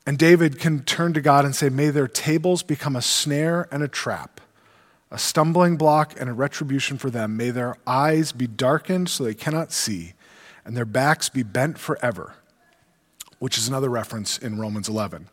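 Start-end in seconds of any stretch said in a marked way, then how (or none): 1.7-12.3 lower, softer solemn reading; scripture reading. Acted. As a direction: read the curse gravely. Reads psalm curse aloud.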